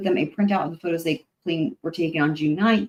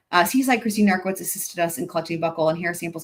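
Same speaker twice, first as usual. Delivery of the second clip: ordinary delivery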